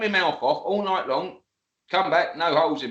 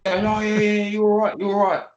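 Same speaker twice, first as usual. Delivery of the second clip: imitating the old boy; 5 semitones higher, 4 dB louder than usual. Voicing the old boy reassured.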